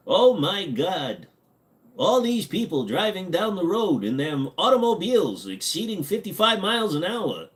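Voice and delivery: put-on high, swooping old-time voice; hammed-up, high-pitched alarm; shocked old-timer. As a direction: act scandalized like someone from decades ago. Acts old-timer shocked by modern cars.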